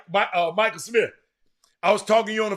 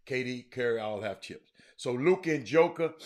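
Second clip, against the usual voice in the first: ordinary talk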